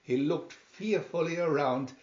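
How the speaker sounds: ordinary delivery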